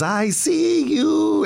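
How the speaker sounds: very high, slow, drawn-out mock warning; mom's spooky call. Rambo Mom catching her son at the handball courts, high, slow mock warning. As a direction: stretch it out like a flashlight ghost. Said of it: "she goes".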